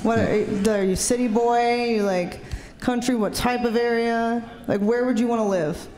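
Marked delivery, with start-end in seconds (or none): none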